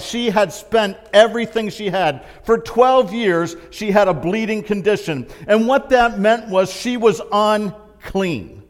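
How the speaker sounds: loud, high, driving sermon voice; preaching. Real, not acted. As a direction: preach it with rising fire to the hall.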